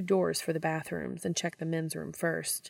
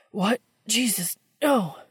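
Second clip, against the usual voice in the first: breathless rising yell; alarmed cry; 4 semitones higher, 4 dB louder than usual. Acted. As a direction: blurt it out, panicked. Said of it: "Sam cries, breathing heavily".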